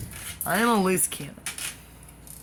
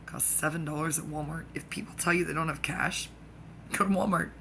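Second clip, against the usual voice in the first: ordinary talk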